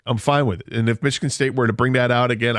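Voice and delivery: ordinary delivery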